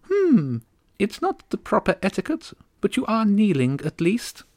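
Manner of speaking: high, haughty little voice; a tiny queen looking down on him; tiny regal bird. Acted. Starling countess greeting a kneeling boy, high and regal. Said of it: "Her voice was tiny and regal".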